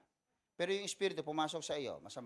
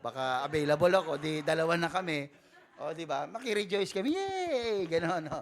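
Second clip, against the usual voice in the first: louder, high and playful, cheering; excited joking; 3 semitones higher, 11 dB louder than usual. Real, not acted. Pastor joking that he will join the lottery celebration, loud and playful. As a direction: build it up to a happy yay.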